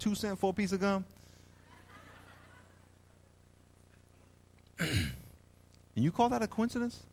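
lightly voiced God, softly incredulous; hushed, mildly offended God; God in disbelief. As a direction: question them quietly as a baffled God. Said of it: "Here's God in heaven".

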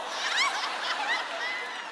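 high burst of laughter; real hearty laugh. Real, not acted. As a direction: crack up at the punchline. Laughing at own story.

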